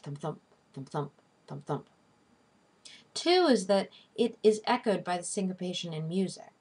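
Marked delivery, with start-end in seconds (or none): 0.0-1.9 soft paired beats, "thump, THUMP", second one stressed; heartbeat rhythm. Shown as a demonstration. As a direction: mimic a heartbeat with low-key pairs of "thump, thump". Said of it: "it mimics the heartbeat".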